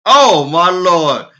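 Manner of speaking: loud, slow, drawn-out delighted cry; excited shout. Real, not acted. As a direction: belt it out in joyful disbelief. Streamer thrilled a viewer answered.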